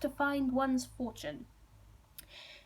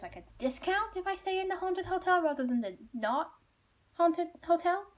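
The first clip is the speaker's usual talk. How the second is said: high-pitched, wheedling retold question; hopeful asking; 8 semitones higher than usual. Traveller asks innkeepers for a discount, hopeful. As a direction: angle for a bargain in a raised voice.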